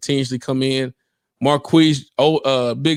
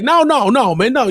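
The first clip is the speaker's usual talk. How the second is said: a higher, louder mock protest; imitating Jack; 7 semitones higher, 5 dB louder than usual. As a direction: yell no like an excited friend. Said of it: "And your boy Jack".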